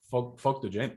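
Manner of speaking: ordinary talk, nothing marked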